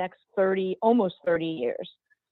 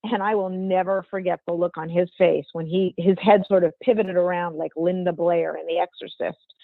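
ordinary talk, nothing marked

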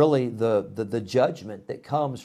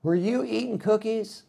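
high, softer suspicious parent; parent's question; 8 semitones higher, 4 dB quieter than usual. Retold or imitated. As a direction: catch the kid in the act. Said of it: "mom or dad come in and say".

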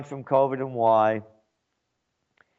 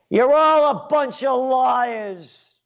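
slow, high, loud yelling; 엄마가 군중에게 거짓말쟁이라고 소리친다; mother's yell; 12 semitones higher, 5 dB louder, much slower than usual. Retold or imitated. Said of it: "My mother yells out".

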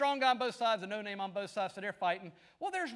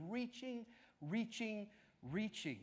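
softer and softer reaching; fading repetition; 11 dB quieter, slower than usual. Acted. The preacher picturing Christ reaching out, fading softer. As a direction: let each reaching fade away.

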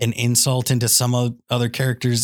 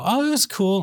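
breezy casual gamer; 7 semitones higher than usual. Retold or imitated. Voicing a casual player.

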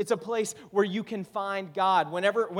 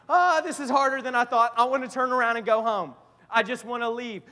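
louder, higher exasperated outburst; a touch of acted, fed-up griping; mock complaint; 5 semitones higher, 7 dB louder than usual. A struggling believer wants to give up, lightly acted exasperation. Acts out a believer hitting troubles.

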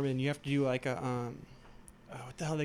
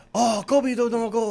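mock Japanese accent; 7 semitones higher, 4 dB louder than usual. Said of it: "You sound like a Japanese accent".

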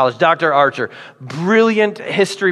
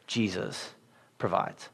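hushed refrain; 10 semitones lower, 16 dB quieter than usual. Acted. Refrain repeated, slower, quieter.

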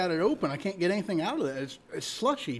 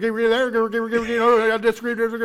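auctioneer; 4 semitones higher, 7 dB louder, faster than usual. Pretend. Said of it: "Do it like an auctioneer the whole fucking time?".

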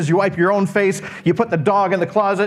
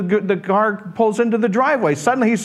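normal talking voice

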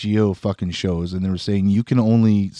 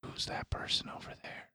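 a hushed whisper; 22 dB quieter than usual. Retold or imitated. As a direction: whisper it so nobody hears. Quoting himself puzzled at work.

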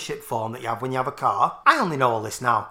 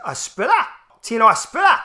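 higher-pitched Geordie exclamation; mock Geordie accent; 7 semitones higher, 4 dB louder than usual. Geordie fan reacting to the Tino Asprilla shirt, mock Geordie accent. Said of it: "I would do Geordie say".

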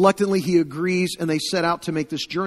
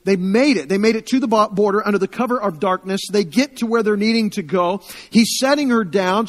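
ordinary talk, nothing marked

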